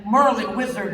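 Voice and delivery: a touch of grand wizard voice; mock game hero. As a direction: proclaim like a video game hero.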